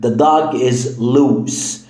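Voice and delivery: The dog is loose, very slowly spelled out; slow example. Shown as a demonstration. Teacher reading the example slowly. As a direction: say the example word by word. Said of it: "لما أقول لك".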